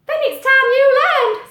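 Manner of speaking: high, loud chirpy bird voice; little bird voice. Acted. Little bird greeting Pete, high and loud. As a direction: squeak it out like a tiny bird.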